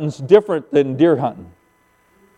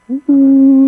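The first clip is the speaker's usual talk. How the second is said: higher, drawn-out admiring coo; impressed ooh; 10 semitones higher, 5 dB louder than usual. Acted. Hunter spots Grandpa's big rack, impressed ooh. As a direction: ooh at the sight of a prize. Acts awe at big deer.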